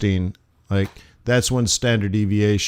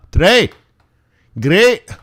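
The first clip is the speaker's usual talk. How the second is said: bright, loud and high cheer; pumped-up shout; excited exclamation; 7 semitones higher, 6 dB louder than usual. Real, not acted. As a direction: shout it like a hype man. Hyping trades, exclaiming.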